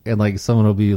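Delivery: usual speaking voice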